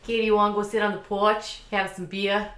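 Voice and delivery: quick imitated Boston accent, dropped Rs; thick Boston accent. Boyfriend inviting Katie to the porch, thick Boston accent. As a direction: run it together like a Bostonian. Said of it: "he had a thick accent".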